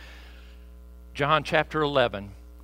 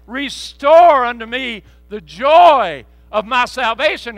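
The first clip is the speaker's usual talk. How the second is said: slow, loud, much higher proclamation; climactic shouted prayer; 10 semitones higher, 15 dB louder, slower than usual. Acted. Pastor crying out Roger's prayer to the church, slow and loud. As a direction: cry the prayer out to heaven.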